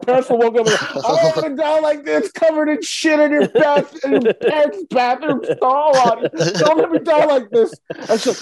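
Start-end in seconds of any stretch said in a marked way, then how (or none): 0.9-7.7 high, whiny imitation of crying; mock crying plea. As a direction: beg high, hamming up the tears. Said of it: "crying, like".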